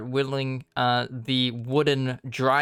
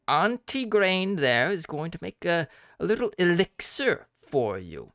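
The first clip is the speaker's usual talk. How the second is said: hag voice; 4 semitones higher than usual. Acted.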